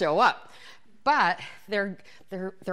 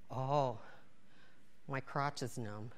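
low, hushed retold discovery; numb realization; 9 semitones lower, 9 dB quieter, slower than usual. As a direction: murmur it low, taking stock of the damage. Discovers numbness after hard sitting.

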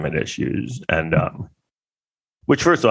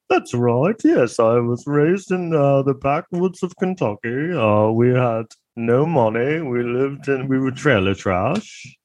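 slow, higher mock British accent, drawn out; Johnny Depp's British affect; 5 semitones higher, slower than usual. Depp answering the lawyer about Kentucky, in a slow mock British accent. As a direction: put on a pretentious British accent, slowly. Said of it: "an affect in his voice".

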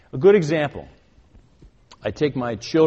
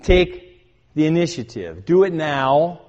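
loud, high, building to a forceful peak; emphatic, compelling preacher's exhortation; passionate urgent command; 5 semitones higher, 7 dB louder than usual. Acted. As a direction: fire off each order like an impassioned commander. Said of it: "It's passionate, it's compelling, and he commands us".

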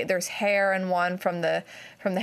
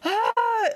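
high panicked "Ah!" cry; a sudden high, louder scream of panic while running; panicked yell; 3 dB louder than usual. Retold or imitated.

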